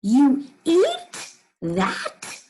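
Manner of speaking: very slow, stretched, stress on "eat"; slow astonished question; surprised question. Shown as a demonstration. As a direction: lean hard on "eat" in shock. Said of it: "Try it with me".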